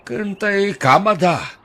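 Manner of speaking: usual speaking voice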